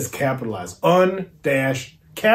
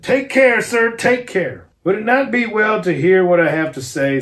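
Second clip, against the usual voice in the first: cold controlled threat to the professor; tense warning; 5 semitones higher, 5 dB louder than usual. A narrator's reading. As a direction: take care said high and firm, the second one slowed.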